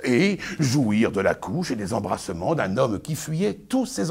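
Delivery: pompous Arnolphe, lecturing his bride; booming, sermonizing stage declamation; hammy stage actor. Acted. Performs Arnolphe's tirade.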